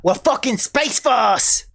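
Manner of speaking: mock Aussie taunting; Australian accent. Aussie space force taunting America, cocky Australian accent. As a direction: goad them in a broad Australian accent. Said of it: "My, my Australian accent is fucked".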